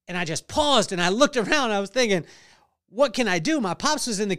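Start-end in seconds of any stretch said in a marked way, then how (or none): none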